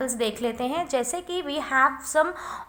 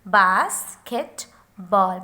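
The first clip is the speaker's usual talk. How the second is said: basketball very slowly, low, in three beats; syllable by syllable; 4 semitones lower, much slower than usual. Shown as a demonstration. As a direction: separate bas, ket and ball. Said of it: "long vowel".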